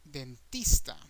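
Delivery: "dentista" as den-TEES-ta, loud on TEES; Spanish "dentista", ending in -eesta; Spanish word demo. Shown as a demonstration. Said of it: "it's going to be "ista".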